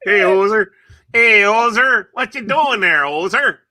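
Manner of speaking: loud, high-pitched Canadian caricature; mock Canadian accent. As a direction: holler like a hammed-up Canadian. Canadian caricature after Canadian is announced.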